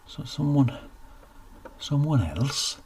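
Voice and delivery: can't believe it; shocked repeat; dumbfounded. Acted.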